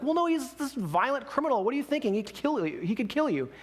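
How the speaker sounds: high, animated mock alarm; alarmed warning. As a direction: warn him off, acting mildly aghast. Others warn John, retold exchange.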